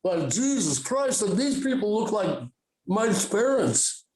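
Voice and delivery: slow, drawn-out tripping stoner; stretched-out, amazed druggy drawl; acid voice. Acted. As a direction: imitate someone dazed on acid. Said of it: "I'm doing my acid voice now".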